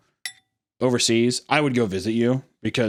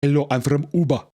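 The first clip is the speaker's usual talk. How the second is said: higher-pitched fake Cuban accent, "OO-ba"; mock Cuban accent; 4 semitones higher than usual. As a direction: introduce yourself with a put-on Cuban accent. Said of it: "but they say Cuba".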